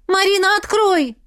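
high loud plea through the door; calling out. Acted. Mother begs her daughter through the locked door. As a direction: call through the door to your girl.

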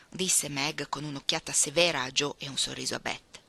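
ordinary delivery

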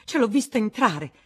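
exclaiming. A narrator's reading. Woman insisting she saw him enter, exclaiming angrily. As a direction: fling out the proof in outrage. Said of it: "esclamò la Vatnaz".

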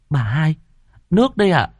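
a hint of a humble servant girl; 1 maid timidly. A narrator's reading. Maid bringing the washing water, timid.

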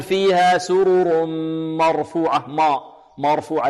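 مرفوعة with a thick, dark ma; slow chant with ما backed and heavy; heavy m, wrong way. Shown as a demonstration. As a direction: demonstrate the fault, making the m of مرفوعة emphatic. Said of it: "نحذر من تفخيم الميم".

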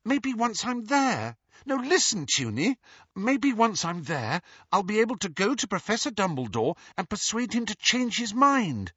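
put-on high, urgent begging girl's voice; pleading. Lily begs her sister, promising to ask Dumbledore, high voice. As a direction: plead and persuade her eagerly.

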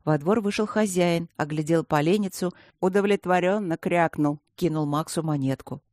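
plain reading voice

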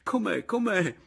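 high, loud tearful questions. A narrator's reading. Berto presses his returned brother, tearful. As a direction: repeat it pleadingly, pitch way up. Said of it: "che piangeva anche lui".

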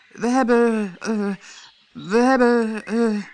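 high, halting stammer; a servant who cannot get it out; submissive stammer. A narrator's reading. Gardener stammers a confession to the queen, high and halting. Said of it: "heel onderdanig".

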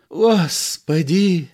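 long, drawn-out slow groan of dismay; moaning. A narrator's reading. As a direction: moan it, holding your head in despair. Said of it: "простонал актёр".

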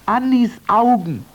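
high, excited exclamation; excited cry. Acted. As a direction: cry it out in joyful surprise. Golden Man recognizes eyes.

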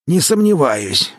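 put-on slow, creaky old man's voice. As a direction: creak it out like an ancient elder. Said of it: "проскрипел старик".

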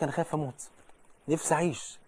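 a hushed mock worry; softly voicing fearful people. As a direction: voice the fearful ones quietly, almost to yourself. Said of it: "كتير من الناس يقول لك".

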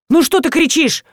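mildly taken aback; surprised question. A narrator's reading. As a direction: ask in surprise at the fuss. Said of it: "удивился эксперт".

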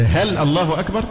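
الله أكبر with the end rising as a question; question tune. Shown as a demonstration. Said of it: "لأن صارت استفهاما". (نبرة استفهام في «أكبر»)

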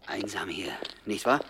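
hushed, fearful whisper; frightened whisper on a lonely street; scared whisper. Acted. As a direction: whisper into the dark night. Scared man whispers in the dark.

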